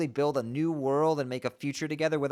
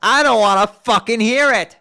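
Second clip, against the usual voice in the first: loud furious shout; Karkat-style yelling; 5 semitones higher, 11 dB louder than usual. Acted. Jade refusing to hear her own crying, furious shout. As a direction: blast it at her. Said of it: "Jade has turned into Karkat".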